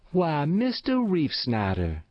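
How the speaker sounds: high, surprised country woman; surprised exclamation. A narrator's reading. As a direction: greet an unexpected caller with astonishment. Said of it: "exclaimed old Matilda herself".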